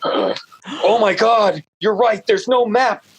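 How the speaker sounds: the author retells his horror at having no map; mock shocked realization. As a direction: act out suddenly realizing the mistake.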